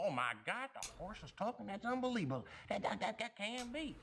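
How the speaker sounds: amazed farmer. Acted. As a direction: stammer out the farmer's disbelief. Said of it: "And the farmer goes".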